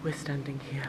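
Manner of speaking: whispering in an echoey room; hushed whisper with room echo. Real, not acted. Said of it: "it's so echoey in here".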